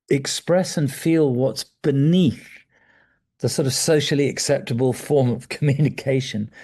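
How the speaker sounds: ordinary talk, nothing marked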